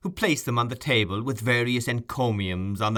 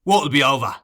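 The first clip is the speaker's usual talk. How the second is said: a louder, savage snap; 4 semitones higher, 8 dB louder than usual. A narrator's reading. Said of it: "in a savage voice".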